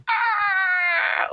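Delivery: very high mock scream; imitated film scream. Podcaster imitates the scream after the music build-up, high mock scream. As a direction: squeal like a sudden jump-scare shriek. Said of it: "Och sen kommer det så här".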